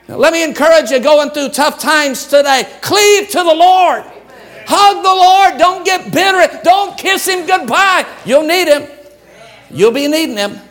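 high, shouted plea to the church; fiery exhortation. Acted. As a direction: urge them hard not to let go. Preacher exhorting the congregation.

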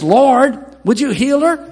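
high, loud plea to the Lord; pleading Moses. Retold or imitated. Moses begging the Lord to heal his sister, loud and high.

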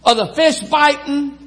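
high, folksy call to the fishermen; breezy country hello from the shore; friendly y'all greeting; casual fisherman greeting. Retold or imitated. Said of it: "Modern vernacular".

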